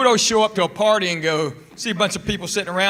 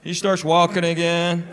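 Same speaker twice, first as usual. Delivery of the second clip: ordinary delivery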